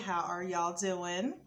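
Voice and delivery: lightly Southern, friendly greeting; Southern twang. Real, not acted. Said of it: "that y'all was very, uh, Southern sounding".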